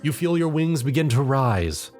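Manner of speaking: ordinary talk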